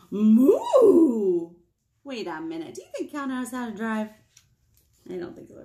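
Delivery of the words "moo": mooing cow. Pretend. Reading a wordless cow book.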